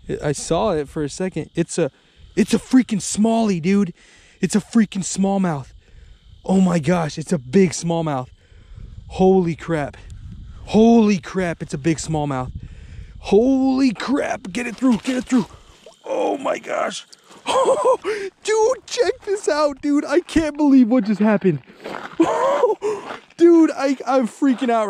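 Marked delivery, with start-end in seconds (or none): 2.3-8.3 excited. Real, not acted. 9.1-11.6 excited exclaim. Real, not acted. 13.2-15.5 thrilled shout. Real, not acted. 16.0-20.0 squealing high laugh, slowed; giddy laughing. Real, not acted.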